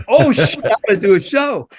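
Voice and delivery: high, sudden oh shit, rushed; startled oh shit. Retold or imitated. Roadie realizing he has a show, startled. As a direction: jolt of panic as it hits you.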